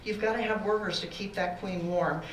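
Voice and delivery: usual speaking voice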